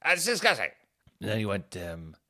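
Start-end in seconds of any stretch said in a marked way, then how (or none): 0.0-0.7 high-pitched cartoon indignation; Terrence and Phillip. Appalled dad as a cartoon duo, high mock disgust. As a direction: put on a high, hammed-up voice of outrage. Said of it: "It's like Terrence and Phillip".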